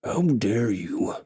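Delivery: slow hushed menace; whispered orc outrage. Acted. Orc king hissing at the elf, slow and hushed.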